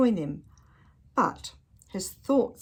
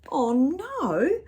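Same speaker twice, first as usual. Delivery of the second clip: alarmed; 7 semitones higher, 5 dB louder than usual. Acted. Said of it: "shouted Percy".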